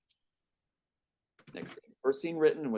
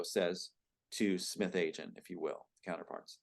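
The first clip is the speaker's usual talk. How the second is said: normal talking voice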